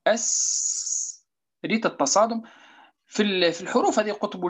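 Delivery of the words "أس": "أس" with a long, hissing s held out very slowly; drawn-out sin demo. Shown as a demonstration. Teacher shows students a sakin sin, holding a long hiss. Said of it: "ناخذ مثلًا سين".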